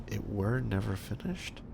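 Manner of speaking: quiet, slow puzzled rereading; puzzled question. Real, not acted. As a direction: reread it softly, puzzled by the grammar.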